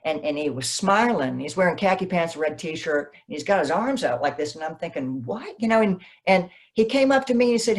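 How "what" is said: high, soft "what?"; hushed, high-pitched astonishment; stunned disbelief. Retold or imitated. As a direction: replay a quiet double take. Retelling surprise at seeing her idol.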